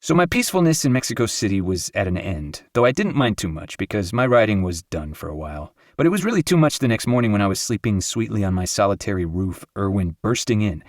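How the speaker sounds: ordinary delivery, nothing marked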